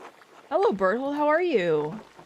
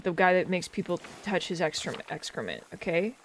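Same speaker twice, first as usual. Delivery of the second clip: ordinary talk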